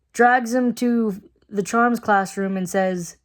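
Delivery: ordinary talk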